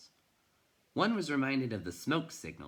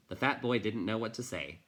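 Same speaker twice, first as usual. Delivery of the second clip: ordinary delivery, nothing marked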